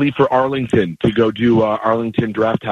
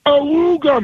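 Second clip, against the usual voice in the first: ahooga horn; 14 semitones higher, slower than usual. Acted. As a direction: blare it like an old car horn. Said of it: "Jeff Bridges do the awooga sound".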